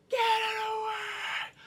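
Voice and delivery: very high, drawn-out shriek; horrified mock scream. Mother recoiling from newborn, high mock screaming. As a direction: stretch the words into a screech. Acting horror at the baby.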